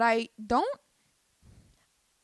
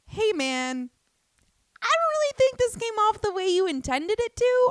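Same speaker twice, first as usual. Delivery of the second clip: loud, very high acted reply; high-pitched polite protest; 12 semitones higher, 8 dB louder than usual. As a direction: push back in a raised, squeaky-high voice. Acting out an offended reply.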